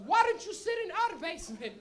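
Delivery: put-on high, loud Portuguese-accented mom; Portuguese mother. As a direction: plead as an indignant Portuguese mother. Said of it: "she's a little Portuguese lady".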